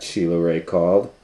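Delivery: ordinary delivery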